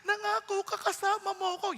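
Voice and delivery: high, slow, pleading cry to God; praying desperately for help; pleading prayer. Retold or imitated. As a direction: beg God with a rising, tearful voice. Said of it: "Pray ako".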